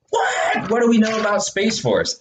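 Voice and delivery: high shouted question; excited shout. Acted. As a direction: burst out in mock alarm. Mock alarmed host.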